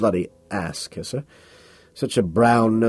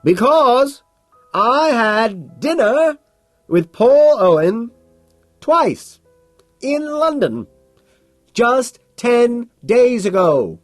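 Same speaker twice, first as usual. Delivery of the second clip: much higher, loud, slow and distinct; shouting back clearly; 6 dB louder, much slower than usual. Acted. Carnes shouts back at Bateman over the club noise. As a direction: shout each word over the din. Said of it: "shouts back very clearly over the din".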